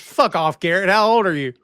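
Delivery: high, loud mock outrage; indignant comeback. Colleague rebuffing Garrett's question about his age, indignant comeback.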